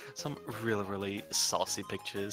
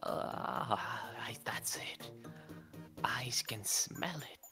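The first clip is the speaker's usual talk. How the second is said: whispered, creaky character voice; creaking voice; 9 dB quieter, slower than usual. Acted. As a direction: rasp it out in a dry creak. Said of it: "in a creaking voice".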